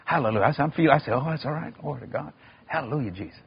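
quietly mumbling hallelujahs; nervous hushed prayer. Retold or imitated. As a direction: mutter praise under your breath while scared. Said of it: "And I'm going".

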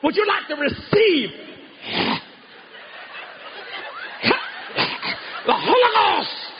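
high, drawn-out mock revival invitation; imitated preacher. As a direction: stretch out the offer like a fervent preacher. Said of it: "he talked like this".

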